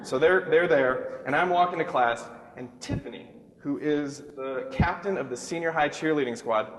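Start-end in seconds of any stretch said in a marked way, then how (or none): none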